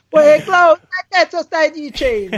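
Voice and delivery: high, loud hammed-up Neapolitan shout; Neapolitan accent. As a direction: yell it like a Neapolitan hothead. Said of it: "Gli fa".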